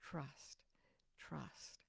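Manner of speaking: breathy whispered trust, three times; soft mesmerizing whisper of trust. Retold or imitated. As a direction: breathe the words like a hypnotic voice. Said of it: "something was saying to me".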